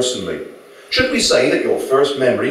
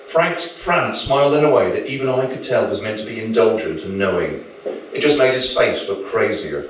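usual speaking voice

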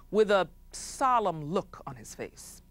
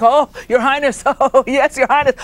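fawning courtiers, loud; 7 semitones higher, 13 dB louder than usual. Acted. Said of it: "they were like".

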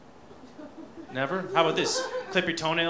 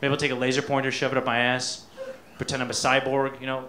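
ordinary talk

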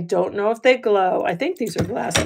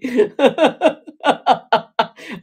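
loud, rolling laughter; long laughing fit; 3 semitones higher, 5 dB louder than usual. Real, not acted. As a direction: keep laughing helplessly. Giggling fit with viewers.

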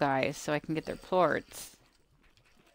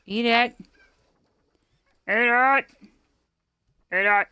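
louder, higher, pushy repeated command; insistent urging; 6 semitones higher, 10 dB louder, much slower than usual. Real, not acted. Gamer shouting at slimes to eat, loud insistent.

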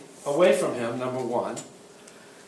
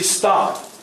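a short, firm "Stop." given as an order; firm command; 4 semitones higher than usual. Shown as a demonstration. Instructor shows the command to give an attacker: firm "Stop.". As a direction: bark "Stop." like an order, not a request. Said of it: "You give commands".

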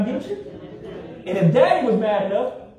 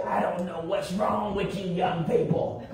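imitating raspy elder; 6 semitones lower, 7 dB quieter than usual. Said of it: "his very raspy voice".

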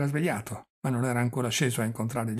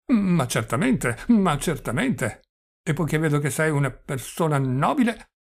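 put-on doddering old prince, confiding; 2 semitones higher, 5 dB louder than usual. (vecchio principe vanitoso, parole trascinate)

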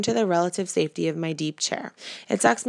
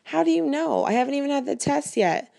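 high, anxious disbelief; can't believe it yet; incredulous; 7 semitones higher than usual. Acted.

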